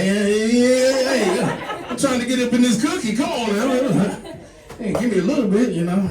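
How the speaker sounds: high, excited and pushy; eager horny guy. Acted. Comic lover begging his partner, high and eager.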